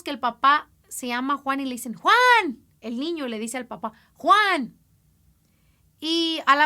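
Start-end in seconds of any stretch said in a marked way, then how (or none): 1.9-2.6 put-on child calling out. Child calls father by name. 4.1-4.8 put-on child calling out. Child calls father by name.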